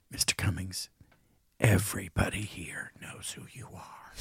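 whispered, low and soft; retold waiter whispering reverently; hushed whisper. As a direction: lean in and whisper it. Waiter tells him everyone knows.

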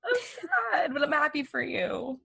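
high, wavering voice through tears; crying. Real, not acted. Cohost tells her partner goodbye, crying. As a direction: speak through tears, happy and sad. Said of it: "stop crying".

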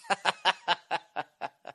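drawn-out chuckle; laugh. Real, not acted. Host amused by his game's name, chuckling. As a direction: laugh at your own joke.

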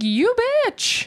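high-pitched indignant retort; mock outrage. Podcast host reacting to the slap scene, mock outrage. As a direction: fire it back in mock offense.

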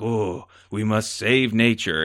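slow, imitated Japanese accent. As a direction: draw out a mock-solemn line in a Japanese accent. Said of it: "if any of my accents were insensitive".

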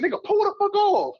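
high-pitched mock panic; panicked urging. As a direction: urge the driver to flee, voice way up. Retold panicked order to drive away.

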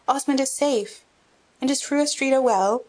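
high, eager greeting; joyful exclamation. A narrator's reading. Boy running to the old baron, greeting him with joy. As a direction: rush to him crying out his name. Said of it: "with outstretched arms exclaimed".

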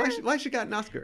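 high, fast mock disbelief; mock incredulous. Mocking the actress's Oscar win, high and fast. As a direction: squeal it in disbelief.

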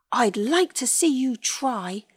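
plucky little hero; brave defiance. A narrator's reading. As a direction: stand up to the bully. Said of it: "Colin said bravely".

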